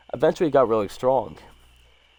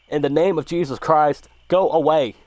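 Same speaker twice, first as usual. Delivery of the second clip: higher, louder acted shout; commanding shout; 6 semitones higher, 6 dB louder than usual. A narrator's reading. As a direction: order it away forcefully, building to the end. Cousin fires rifle, orders creature away.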